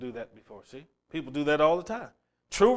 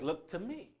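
hushed; 13 dB quieter than usual. Shown as a demonstration. Preacher quoting God's call Look to me, soft and hushed. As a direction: Look to me dropped to a soft hush. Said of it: "God says".

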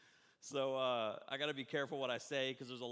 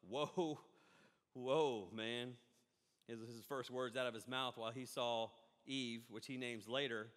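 ordinary talk, nothing marked

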